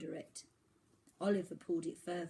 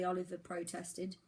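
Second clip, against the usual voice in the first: ordinary delivery, nothing marked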